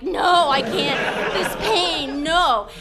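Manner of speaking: loud, slow pained protest; pained refusal. Retold or imitated. Patient refusing shots from doctors, loud slow pained protest. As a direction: refuse through the pain, loud. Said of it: "I'm like".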